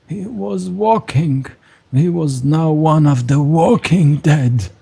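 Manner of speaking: high, slow lament for a lost son; grieving priest. Acted. Priest telling the strangers about his undead son. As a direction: mourn the son you locked away. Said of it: "he says".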